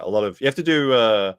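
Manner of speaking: normal talking voice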